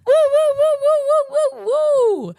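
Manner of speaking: very high, loud repeated woos; high-pitched hype whoops; whooping. Pretend. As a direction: cheer yourself with shrieked whoops. Cheers own introduction.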